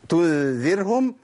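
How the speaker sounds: "تنذرهم" slowly with a thin, vowelless ر; light still ر. Shown as a demonstration. Teacher explaining the rule for the second ر, slow light "تنذرهم". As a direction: draw out "تنذرهم", keeping ر light after kasra. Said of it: "ترقق".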